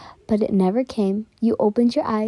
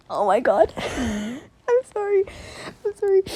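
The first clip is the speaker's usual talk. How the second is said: gasp, then apologize through giggles; flustered; 2 semitones higher than usual. Real, not acted. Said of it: "I'm just really flustered about that".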